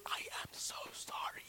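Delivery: soundless mouthed apology; mouthed whisper. Shown as a demonstration. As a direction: barely breathe the words. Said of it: "mouthing the words".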